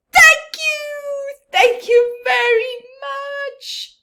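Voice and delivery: little blue fish overjoyed, high loud thanks; delighted thanks. Acted. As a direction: burst out thrilled as the tiny fish. Little fish gets scale, thrilled.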